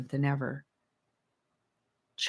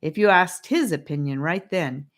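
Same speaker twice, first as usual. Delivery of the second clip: plain reading voice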